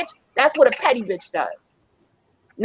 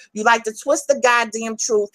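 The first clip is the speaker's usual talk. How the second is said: ordinary talk, nothing marked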